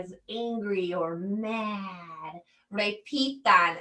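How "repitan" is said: ordinary delivery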